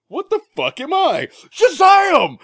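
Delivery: high screaming villain cry; Doom shout. Retold or imitated. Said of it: "Doom is like".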